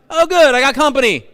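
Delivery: high, loud mock cheerful voice; Balaam's mock delight. Preacher voicing Balaam answering the donkey, high loud mock cheer. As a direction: play Balaam glad of the company.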